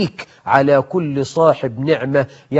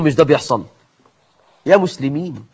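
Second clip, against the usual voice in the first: ordinary talk, nothing marked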